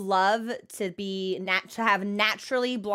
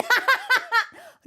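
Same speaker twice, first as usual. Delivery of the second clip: high, amused laugh; burst of laughter; 14 semitones higher, 4 dB louder than usual. Real, not acted. She laughs as someone gets banned right after praise. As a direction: laugh at the irony.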